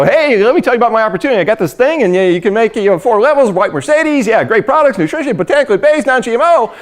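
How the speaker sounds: high, rapid-fire pushy spiel; mock sales pitch. Mock seller accosts someone at the mall, breathless-paced spiel.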